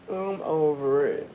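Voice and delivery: hushed, fading I'm over it. Real, not acted. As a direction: let I'm over it trail off into nothing.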